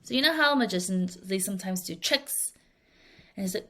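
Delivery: ordinary delivery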